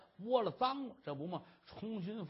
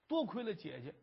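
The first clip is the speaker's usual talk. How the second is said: ordinary delivery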